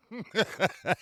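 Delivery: a high, breathy giggle; laugh. Real, not acted. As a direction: laugh at your own joke.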